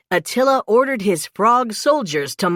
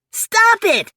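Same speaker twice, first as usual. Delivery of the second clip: high, louder startled cry; 14 semitones higher, 4 dB louder than usual. A narrator's reading. Mikey cries out as a frog lands on his head, high and loud. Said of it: "Mikey cried".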